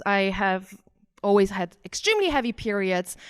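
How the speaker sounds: usual speaking voice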